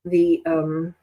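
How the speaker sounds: ordinary talk, nothing marked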